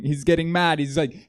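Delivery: usual speaking voice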